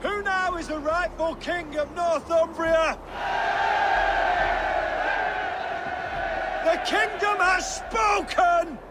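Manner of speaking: high, full-voiced proclamation to a crowd; ringing high cry, stretched out at the end; rallying shout. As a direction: shout it out to the whole army. Proclaiming king before a crowd.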